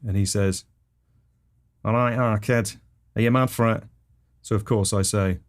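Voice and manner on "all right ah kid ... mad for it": [name]-fan neighbour voice; Chip. Acted. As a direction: put on the rock-mad neighbour. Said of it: "who is a massive [name] fan".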